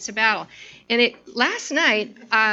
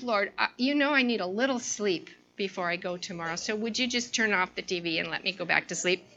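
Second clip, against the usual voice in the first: quiet weary prayer; 4 semitones lower, 6 dB quieter than usual. Retold or imitated. Retelling her weary night-time prayer.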